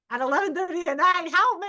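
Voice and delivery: high, loud, hurried cry for help; hammed-up frantic phone plea; retold tearful plea. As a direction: wail it in acted-out hysteria. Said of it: "sobbing, crying. I couldn't even see".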